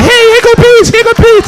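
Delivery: very high, loud crazy-lady voice; hammed-up lady calling out in a high pitch.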